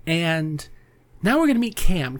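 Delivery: ordinary talk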